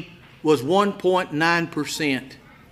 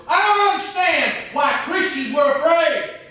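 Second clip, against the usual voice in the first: louder and higher, pleading in disbelief; exasperated outcry; 10 semitones higher, 7 dB louder than usual. Real, not acted. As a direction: cry out in disbelief at the flock. Preacher rising in exasperation.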